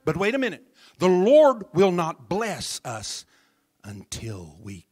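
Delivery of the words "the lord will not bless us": ordinary delivery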